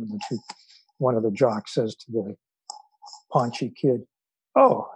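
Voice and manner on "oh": a high, loud mocking "Oh"; sarcastic. Jock taunting the paunchy kid, a high mocking "Oh". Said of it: "in a sarcastic tone of voice".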